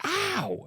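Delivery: high mock "ow" yelp; retold yelp of pain. Dad imitating his son's bumped head, high mock yelp. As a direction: imitate a kid crying out in pain.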